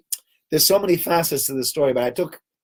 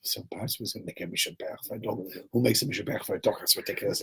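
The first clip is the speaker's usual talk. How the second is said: embarrassed muttering; 4 semitones lower, 10 dB quieter, faster than usual. Retold or imitated. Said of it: "this taxi driver is like".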